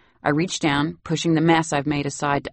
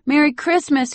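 put-on much higher little-boy goodbye call; excited child farewell; 12 semitones higher, 4 dB louder than usual. Little boy calling farewell to nanny, excited and high. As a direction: wave goodbye at full wattage.

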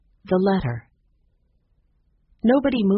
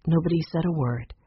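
plain reading voice

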